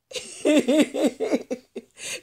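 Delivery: real high-pitched giggle. Real, not acted. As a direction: squeal with laughter at the thought of her. Laughs about one of the aunties.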